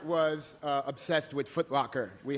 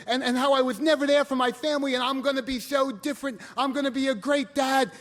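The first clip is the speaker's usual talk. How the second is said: high, urgent imitation building louder; fervent confession; 7 semitones higher, 5 dB louder than usual. Father vowing change from hospital bed, fervent outpouring. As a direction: pour it out like an overwhelmed father.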